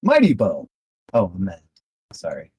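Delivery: normal talking voice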